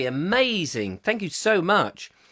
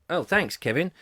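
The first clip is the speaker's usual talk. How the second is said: ordinary delivery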